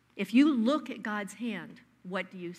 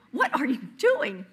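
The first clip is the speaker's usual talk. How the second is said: louder, indignant what are you doing; a husband robbed of his cookie; stunned protest; 4 semitones higher, 7 dB louder than usual. Acted. Husband to his wife at the trash, stunned and loud.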